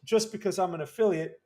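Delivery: ordinary delivery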